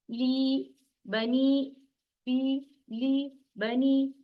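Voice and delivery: slow, each long ee in "ली बनी" held two beats; stretched long vowels. Shown as a demonstration. Teacher modelling for students, "ली बनी" slowly stretched. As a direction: draw out "फी", "ली" and the end of "बनी" evenly. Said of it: "खींच के पढ़ेंगे".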